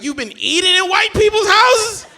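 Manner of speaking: high, loud, slow shocked question; hammed-up horrified granddad, drawing it out; scandalised disbelief. Granddad's mounting disbelief, acted.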